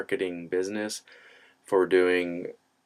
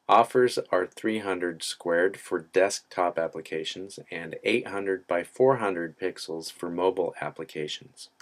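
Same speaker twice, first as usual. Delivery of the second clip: ordinary talk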